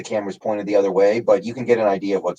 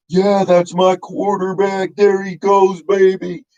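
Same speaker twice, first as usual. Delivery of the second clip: high, drawn-out mock fan scream; hammed-up cheering, pitched far up; Mark Holmes screaming; 11 semitones higher, slower than usual. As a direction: imitate an overexcited fan screaming at a big play. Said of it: "he was actually just screaming".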